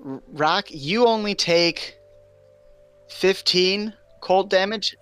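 ordinary talk

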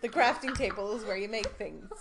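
put-on fast, loud, laughing bossy kid; pestering child. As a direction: nag like an impatient child. Imitating daughter bossing dad.

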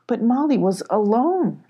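ordinary delivery

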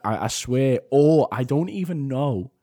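usual speaking voice

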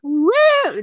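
loud high cowboy whoop; Western woo. Acted. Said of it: "That was so Western".

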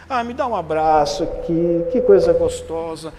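hug him and gush with joy; gushing delight. Retold or imitated. Woman hugs him, moved. (mulher emocionada pede um abraço, fala alto e devagar, encantada)

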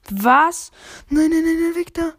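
loud, panicked no no no; yelling in disbelief; shocked shout. Real, not acted. Losing a fight in game.

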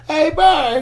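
high-pitched call from above; Lance calling out. Retold or imitated. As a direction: shout up high like a mate spotting you. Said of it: "this voice of Lance Watson going".

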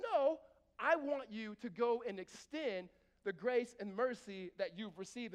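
slow, loud, commanding God; firm God. Acted. Preacher voicing God, firm and slow. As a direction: insist on mercy, slowly. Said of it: "He said".

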